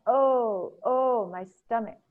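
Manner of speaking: high, loud long "oh"s, easing off; acted moan of a suffering queen; crying out in pain. Ereshkigal moans over her stomach, crying out in pain. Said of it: "she cried out".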